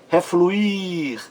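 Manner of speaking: "Refluir" with a high, stretched final I; stress on final I. Shown as a demonstration. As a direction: hold and push the IR of "Refluir". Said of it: "mais forte". ("Refluir" com o I tônico bem alongado)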